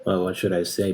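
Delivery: ordinary delivery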